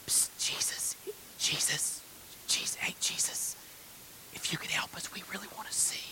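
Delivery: hushed, furtive attention-getting whisper; mock-meek whispering; timid whisper. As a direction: whisper a shy request, barely voiced. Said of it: "They don't say".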